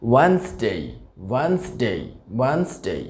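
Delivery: ওয়েডনেসডে as two syllables "WENS-day"; correct pronunciation. Shown as a demonstration. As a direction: say ওয়েডনেসডে with silent first d. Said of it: "সঠিক উচ্চারণটা".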